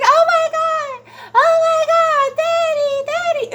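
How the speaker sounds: high, drawn-out panicked wail; imitated screaming and crying. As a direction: imitate a child shrieking for Dad. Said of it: "I just heard screaming and crying".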